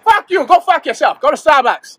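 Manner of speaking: high, loud furious Boston yell; angry shout. Acted. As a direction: spit it out in anger. Angry outburst.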